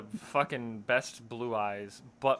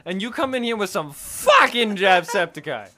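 loud, raised, emphatic scolding; indignant rant; 5 semitones higher, 7 dB louder than usual. Real, not acted. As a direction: tell them off with big punched words. Indignant rant after teasing impression.

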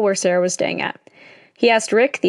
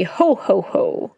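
usual speaking voice

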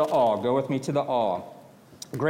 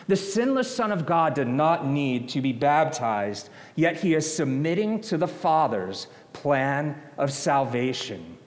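ordinary talk

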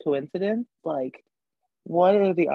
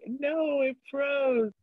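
annoyed; 9 semitones higher, much slower than usual. Retold or imitated. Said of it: "I was so annoyed because I was like".

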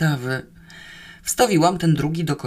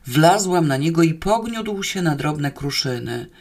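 plain reading voice

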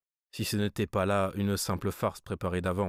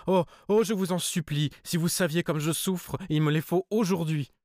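high-pitched, distraught begging; tearful pleading; 9 semitones higher, 4 dB louder than usual. A narrator's reading. Harried cousin begs for money today, high tearful plea. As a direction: plead urgently, as if close to tears. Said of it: "Elle pleurait et bégayait".

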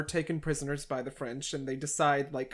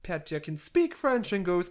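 normal talking voice

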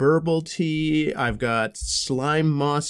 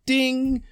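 timer ding; 11 semitones higher than usual. Pretend. Imitating a tea timer.

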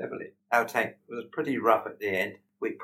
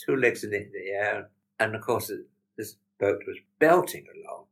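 ordinary talk, nothing marked

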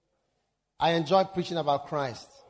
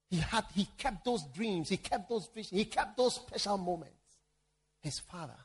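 ordinary talk, nothing marked

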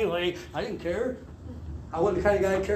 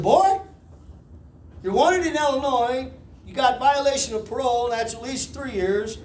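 lightly voiced judge, higher pitch; judge reading charges; 5 semitones higher than usual. Judge reading charges to defendant, lightly voiced authority. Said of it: "he read off my charges to me".

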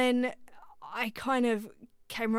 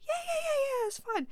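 much higher, tight and anxious; nervous squeak. Retold or imitated. Answering the producer mid-session, a high nervous squeak. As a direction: squeak it out trying to sound fine.

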